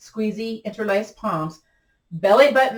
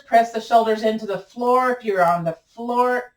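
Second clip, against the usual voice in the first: ordinary talk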